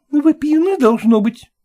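high, loud rebuttal; indignant retort. Acted. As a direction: stand your ground firmly. Hostess retorts.

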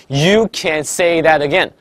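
slowed, every word spaced out; slow clear demo. Shown as a demonstration. Teacher repeating the English phrase slowly for students. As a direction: spell it out slowly for listeners. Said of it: "你听清我在说什么".

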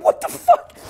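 very high strained cry of disbelief; exasperated outburst. Retold or imitated. Patient reacting to the psychiatrist's advice, high exasperated outburst. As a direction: burst out in high-pitched exasperation.

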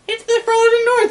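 high, loud mock protest; outraged shout. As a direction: squeal it out like an indignant victim. Mock protest from the frozen lands.

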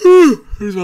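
loud yawn into the words. Real, not acted. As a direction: talk through a big yawn. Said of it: "like, eighteenth yawn today".

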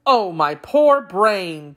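slow, drawn-out wail of bewilderment; distressed cry. A narrator's reading. Jackal wringing its paws over its poor brain, distressed cry. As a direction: lament it slowly, as if the head hurts. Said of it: "cried the jackal".

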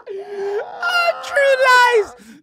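real excited outburst; excited cry. Real, not acted. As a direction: yell it out with glee. Excited about the film.

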